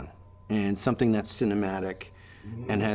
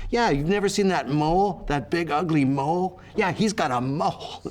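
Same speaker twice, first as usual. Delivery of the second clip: higher, sing-song mean-girl taunt, lightly voiced; mocking mean girl; 7 semitones higher than usual. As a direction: imitate the catty college girls teasing. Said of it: "every time she said it".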